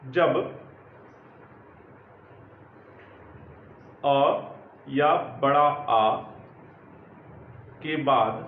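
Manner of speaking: slow, low, word by word with long pauses; slow dictation. Acted. Teacher dictating the rule to students, slow and measured. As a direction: spell it out for them to write down.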